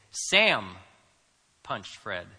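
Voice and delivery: Sam punched hard, then a pause; stress on Sam. Shown as a demonstration. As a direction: hit "Sam" loud and let "punched Fred" drop away. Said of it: "you know we're talking about Sam".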